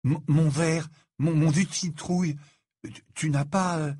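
stammering, halting disbelief; halting question, half frightened, half excited; hushed, scared excitement. A narrator's reading. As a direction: stumble over the words, awed and nervous. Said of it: "avait baissé la voix".

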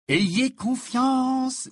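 high, loud, very drawn-out; elated reassurance. A narrator's reading. Harry tells alarmed friends to trust him, elated. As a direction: stretch it out blissfully.

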